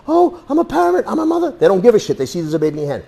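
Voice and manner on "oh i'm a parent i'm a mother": high, pleading wail; mother pleading with a gunman for mercy; begging mother. Pretend. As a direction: beg the gunman to pity you.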